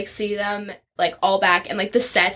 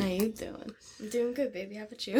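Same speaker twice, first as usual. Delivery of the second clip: soft flirty voice; 11 dB quieter than usual. Retold or imitated. Flirty Joey line continued.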